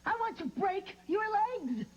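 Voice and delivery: angry. Acted. Furious captive bride.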